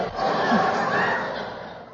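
airy, wheezing laugh; a soundless giggle at your own bit; breathy silent laugh. Real, not acted. Preacher laughing as he mimes looking in the index, airy. Laughing while acting out the search.

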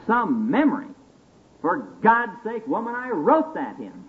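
put-on high, incredulous old drunk; exasperated drunk. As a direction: throw it back in disbelief.

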